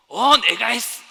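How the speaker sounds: very loud, high yell; explosive, high-pitched proud outburst; boastful blurted shout. Acted. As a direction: burst out claiming the credit. Frog blurts out boastful answer.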